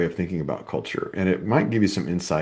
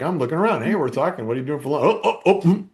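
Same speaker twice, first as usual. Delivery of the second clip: high, nervous cubicle chatter; nervous coworker; 7 semitones higher than usual. Retold or imitated. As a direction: pop up and duck down, rising to a squeak. Coworkers popping up over cubicles.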